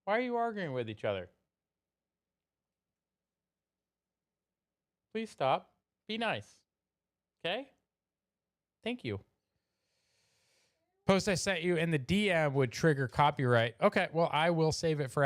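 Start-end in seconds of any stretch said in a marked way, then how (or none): 0.1-9.2 gentle scolding. Real, not acted. Telling off chat fighting.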